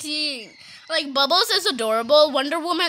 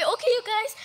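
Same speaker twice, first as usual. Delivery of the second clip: ordinary talk, nothing marked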